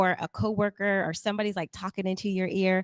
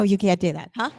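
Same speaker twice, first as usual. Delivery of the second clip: ordinary talk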